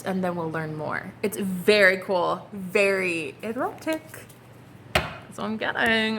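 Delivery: ordinary talk, nothing marked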